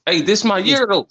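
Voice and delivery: high, loud hype; excited. Real, not acted. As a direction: call it out with pride. Hyped about the new year.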